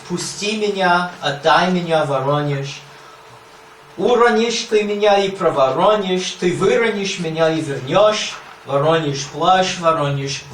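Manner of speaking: measured, incantatory reading of Russian verse; poem recitation. Acted. Lecturer reciting the Voronezh quatrain to students, incantatory. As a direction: chant it like a spell.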